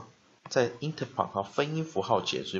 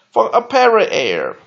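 usual speaking voice

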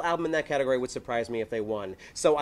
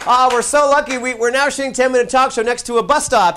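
ordinary talk

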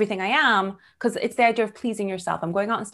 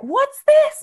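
much higher, louder curious question; surprised question; 9 dB louder than usual. Retold or imitated. Date asking about the hand, surprised and much higher. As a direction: squeak it out, surprised. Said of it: "the dude's like".

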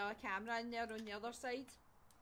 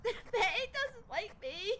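whiny complaint; 11 semitones higher, 5 dB louder, much slower than usual. Acted. Complaining about the ghost.

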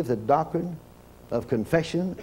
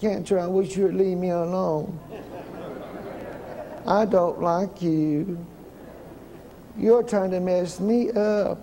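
timid pleading; 4 semitones higher, slower than usual. Shown as a demonstration. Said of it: "You can't go around saying".

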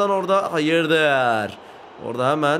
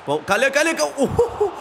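startled shout; 8 semitones higher than usual. Real, not acted. Near goal concedes, disbelief.

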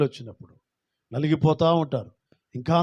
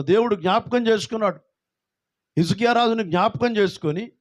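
raised, higher sermon voice; full-force preaching; 7 semitones higher than usual. Acted. Preacher exhorting congregation, fervent preaching. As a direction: preach it out to the hall.

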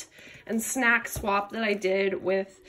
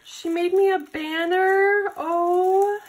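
surprised; 6 semitones higher, 4 dB louder, much slower than usual. Real, not acted. Discovering a handmade banner.

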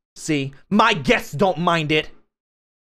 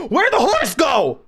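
mock panicked shout; 7 semitones higher than usual. Loud mock-panic question.

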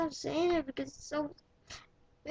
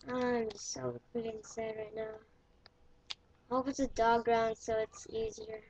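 ordinary talk, nothing marked

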